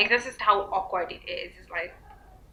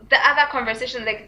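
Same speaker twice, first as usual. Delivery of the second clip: ordinary talk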